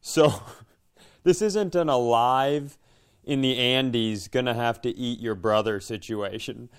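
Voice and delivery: ordinary delivery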